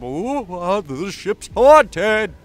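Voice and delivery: slow, hollow mock spooky; spooky ghost voice. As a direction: drag it out like a hammy ghost story. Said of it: "for no adequately explained reason other than".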